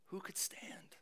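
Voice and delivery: near-whispered echo; haunting hush. Retold or imitated. Preacher echoes Malachi's question about judgment, hushed. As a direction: let it fall to a whisper.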